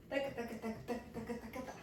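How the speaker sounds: soft, rapid-fire taka patter; rhythmic taka syllables. Shown as a demonstration. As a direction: count the cascade in light percussive syllables. Vocalizing the waterfall dance move.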